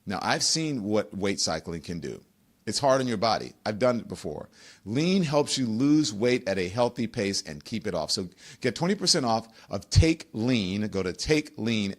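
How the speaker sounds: a low, easy host ad read; sponsor ad read. Acted. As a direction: sell it calmly, like a podcast sponsor spot.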